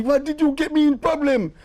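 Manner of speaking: alarmed complaint. Retold or imitated. The guy blames Clive, high and alarmed. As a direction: blame him in a panic. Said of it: "he must say".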